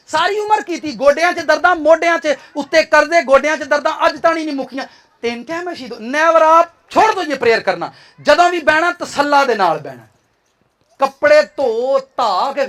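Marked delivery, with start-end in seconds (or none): none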